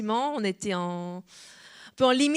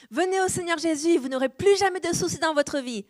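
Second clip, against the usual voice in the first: higher, sing-song false-preacher promise; mock promise; 7 semitones higher than usual. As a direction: parody an easy-gospel salesman pitch. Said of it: "certains ont peut-être fait croire". (imitation moqueuse d'un prédicateur qui promet une vie sans soucis)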